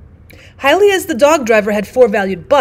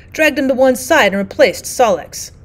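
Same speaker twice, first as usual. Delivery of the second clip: ordinary delivery, nothing marked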